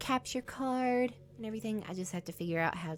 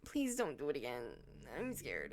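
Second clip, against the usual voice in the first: softer, lower, fading plea; 7 semitones lower, 9 dB quieter than usual. Real, not acted. Begging the game not to scare again, softer and lower. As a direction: let the plea shrink away.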